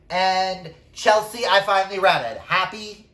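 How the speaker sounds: high, loud mock-exasperated announcement; calling out. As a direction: call it out loudly to a friend who nagged. Calling out to absent friend Chelsea.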